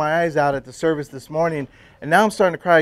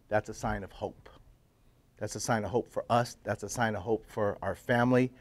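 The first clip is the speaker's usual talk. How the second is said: lower, much softer voice for his wife; hushed tender quote; 6 semitones lower, 11 dB quieter than usual. Retold or imitated. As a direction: quote her hope quietly, close and low. Wife holds on, voices hope.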